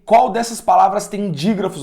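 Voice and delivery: ordinary delivery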